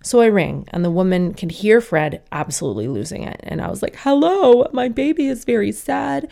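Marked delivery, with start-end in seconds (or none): 4.0-6.3 high, plaintive hello with a loud start; plaintive phone plea. Retold or imitated. Mother phoning the receptionist about her crying baby, plaintive plea. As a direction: plead in a high, put-upon voice.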